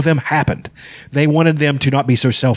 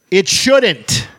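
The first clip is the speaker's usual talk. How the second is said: higher, scolding comeback; indignant retort; 8 semitones higher than usual. Retold or imitated. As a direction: shoot back at her in mock outrage. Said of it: "I was just like".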